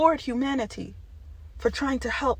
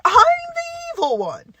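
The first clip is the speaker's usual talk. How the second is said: much higher, crying shout; anguished outcry; 6 dB louder than usual. Real, not acted. Hurt that people call her evil, crying shout.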